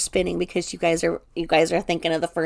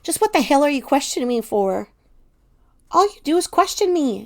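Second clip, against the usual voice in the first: angry, higher-pitched comeback; angry outburst; 6 semitones higher, 4 dB louder than usual. A narrator's reading. You, attacked by Teddy's question, answer angrily. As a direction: lash out as you read the character. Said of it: "And anger rushes through you".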